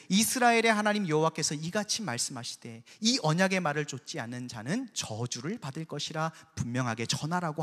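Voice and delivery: normal talking voice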